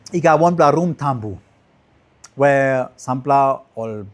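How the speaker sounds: ordinary talk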